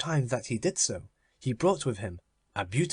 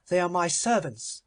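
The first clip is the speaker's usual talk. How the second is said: higher, proud miser voice; Avarice's voice; 6 semitones higher, 3 dB louder than usual. Acted. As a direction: raise the pitch, possessive and cold. Avarice refuses Death.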